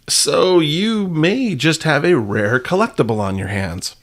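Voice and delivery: ordinary talk, nothing marked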